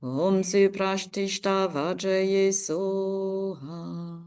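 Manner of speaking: normal talking voice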